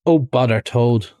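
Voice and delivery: ordinary delivery, nothing marked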